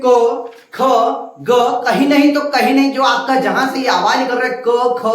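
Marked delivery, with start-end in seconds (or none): 0.0-0.8 क ख sounded from the throat, slow and distinct; throat sound demo. Shown as a demonstration. Teacher showing students where क ख are sounded. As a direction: let क ख come straight from the throat. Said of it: "क ख बोल के देखिए".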